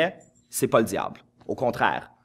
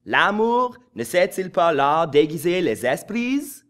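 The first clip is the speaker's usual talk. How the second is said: Molière avec un accent anglais, lent et plus haut; intonation anglicisée; 6 semitones higher, 5 dB louder, slower than usual. Shown as a demonstration. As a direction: lis Molière comme un anglophone. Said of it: "donnez-y une intonation anglicisée". (Molière à l'accent anglais, lent)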